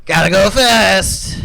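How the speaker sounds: loud, high, stretched-out catchphrase; deliberately bad Sonic. Copying a friend's copy, clumsy loud hero yell. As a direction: badly imitate a cartoon hero, slow and loud. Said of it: "try and not do "Gotta Go Fast" very well".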